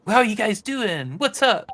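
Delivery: very high, loud, perky cutesy voice; cute character. Shown as a demonstration. Translator showing slangy character C's voice, cute character. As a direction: chirp it out like a cute cartoon character. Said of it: "who's very cute".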